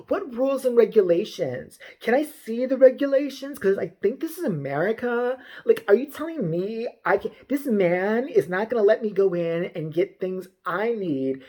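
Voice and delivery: imitation of an indignant, self-important shopper; mock entitled Karen. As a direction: stretch it in affronted mock disbelief.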